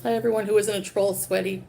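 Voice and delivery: ordinary talk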